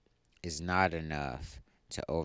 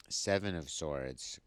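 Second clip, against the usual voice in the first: ordinary talk, nothing marked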